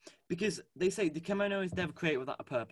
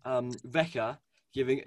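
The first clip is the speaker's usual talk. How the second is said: usual speaking voice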